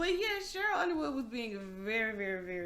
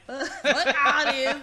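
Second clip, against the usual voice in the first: mock outburst; 4 semitones higher, 15 dB louder than usual. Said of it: "he was like".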